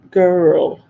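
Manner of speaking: "girl" in a put-on Scottish accent; mock-Scottish "girl". Shown as a demonstration. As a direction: try "girl" the Scottish way, rolling the R. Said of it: "over and over in the Scottish accent".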